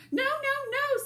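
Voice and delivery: very high, loud tiny wolf voices; squeaky little wolves. Acted. Little wolves refusing the pig through the door, very high and squeaky.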